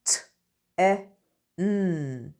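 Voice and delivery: each letter sound said separately, with pauses between. Shown as a demonstration. Teacher to pupils, sounding out a word letter by letter. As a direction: split the word into its single sounds for the class. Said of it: "listen carefully as I sound out".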